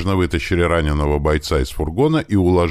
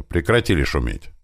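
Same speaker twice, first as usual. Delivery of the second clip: plain reading voice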